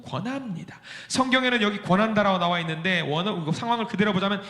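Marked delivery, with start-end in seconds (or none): none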